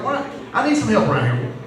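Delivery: imitating a huffy wife, high-pitched complaint; mock huffy plea for help around the house; huffy puffy wife. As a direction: complain high, voice swooping down at each phrase end. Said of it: "she's huffy puffy".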